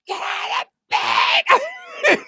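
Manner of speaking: screaming, very high and loud, slow and drawn out; panicked scream. Retold or imitated. Wife on the phone screaming at him to flee, panicked shriek. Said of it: "Freaking out".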